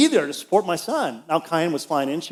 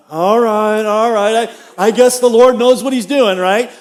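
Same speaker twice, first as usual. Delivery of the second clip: grudging acceptance; 4 semitones higher, 7 dB louder than usual. Retold or imitated. Grudging reply to God.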